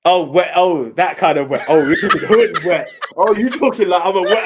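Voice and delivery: amused, loud dawning; laughing realisation. Real, not acted. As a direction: crack up as it dawns on you.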